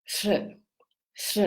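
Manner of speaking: "sh" as r fused with sh, twice; Czech Ř sound. Shown as a demonstration. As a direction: model "sh" as one "rzh" sound, separately. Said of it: "it's pronounced as".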